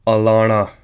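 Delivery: "alana" said loud with a leaned-on middle syllable; changed L tone. Shown as a demonstration. Teacher demonstrating the tonal L, emphatic "alana". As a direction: press into the "la" of "alana". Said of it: "lala's tone has sort of changed".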